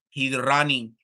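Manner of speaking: a hint of a Russian accent on "He's running"; flat, blunt Russian-accented "He's running"; mock Russian accent. Joking about a Russian fighter, in mock Russian accent. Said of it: "say it like that 'cause it sound worse".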